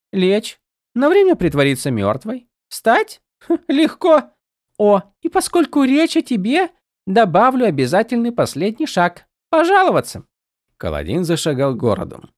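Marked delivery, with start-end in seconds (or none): 0.1-10.2 high, light and teasing; a tiny sprite needling her friend; playful spren voice. A narrator's reading. Голос Сил, насмешка. (высокий игривый голос духа)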